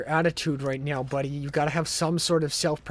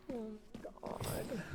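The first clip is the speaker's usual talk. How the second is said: whispered; 6 semitones higher, 15 dB quieter than usual. Real, not acted. Despair at game.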